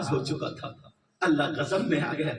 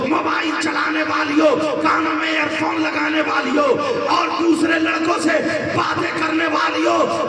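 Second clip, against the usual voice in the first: loud, chanted sermon; fiery sermon, sung and shouted; preaching at full force; 12 semitones higher, 8 dB louder than usual. Acted. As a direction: thunder the warning at the listeners. Full-force preaching, warning girls.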